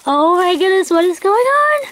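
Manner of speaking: high, rising amazed squeal; excited. Real, not acted. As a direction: pitch climbing in giddy disbelief. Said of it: "I'm so excited".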